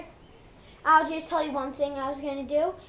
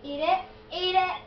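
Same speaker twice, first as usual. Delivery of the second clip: laughing; 6 semitones higher than usual. Real, not acted. Feeding friend mystery spoonful.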